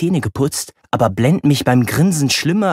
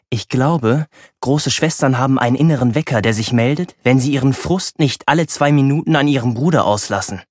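ordinary delivery

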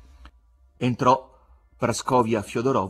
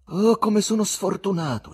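higher, louder lament; a stagy wail of self-pity; woeful exclamation; 10 semitones higher, 5 dB louder than usual. A narrator's reading. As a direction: cry out at his bad luck. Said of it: "esclamò".

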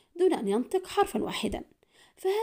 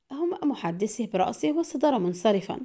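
plain reading voice

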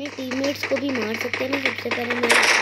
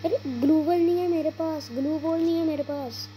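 alarmed complaint; 8 semitones higher than usual. Real, not acted. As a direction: complain in a raised pitch about missing gear. Out of glue walls mid-fight. (घबराई शिकायत, ऊँची आवाज़ में)

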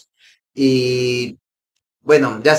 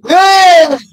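high, loud pleading yell; excited yell; 14 semitones higher, 6 dB louder than usual. Real, not acted. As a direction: scream at the ball to come. Urging a scoring chance.

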